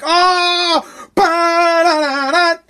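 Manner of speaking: high, slow sing-song party cheer; silly high celebratory chant; mock celebration. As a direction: ham up a clueless crowd cheering. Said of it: "you guys are like".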